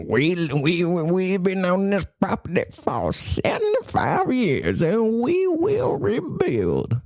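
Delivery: slow, rising, earnest landowner vow; defiant rural landowner. Retold or imitated. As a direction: vow it proudly like a stubborn rancher, slowing down. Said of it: "American culture".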